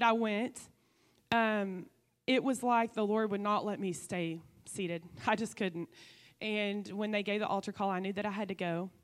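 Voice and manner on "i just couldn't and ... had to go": normal talking voice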